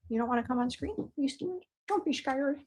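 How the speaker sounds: coaxing husband onto the camera, soft and playful; teasing coax. Real, not acted. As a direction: gently tease him into coming on screen.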